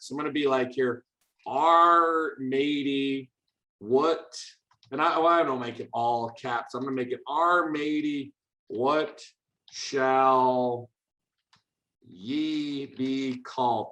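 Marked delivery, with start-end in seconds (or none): 1.5-4.4 very slow, drawn-out pirate. Pretend. Coding teacher typing out the computer's pirate question, pirate voice. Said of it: "We can get it as a pirate". 7.3-13.9 slow, deliberate pirate. Pretend. Coding teacher voicing the pirate computer asking a name, pirate voice. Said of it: "That sounds pretty piratey".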